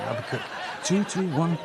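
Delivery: ordinary talk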